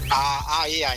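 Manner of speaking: normal talking voice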